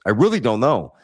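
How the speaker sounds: ordinary delivery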